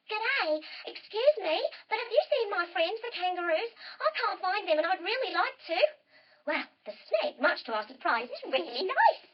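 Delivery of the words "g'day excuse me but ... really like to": high, chirpy kangaroo voice; small high character voice; little kangaroo. Acted. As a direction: pipe up as a small lost kangaroo. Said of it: "hops right up to the snake and says".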